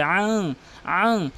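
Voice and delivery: "আং" hummed through the nose; throat ayn into a nasal "আং"; ghunna. Shown as a demonstration. Teacher demonstrates ghunna, nasal hum. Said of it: "গুণনা করতে হবে".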